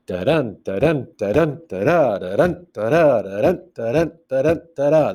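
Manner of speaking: bouncing da-DUN syllables, second one hit; steady sing-song weak-strong beat; chanted rhythm. Shown as a demonstration. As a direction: tap out a limerick rhythm vocally. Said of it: "So the rhythm is".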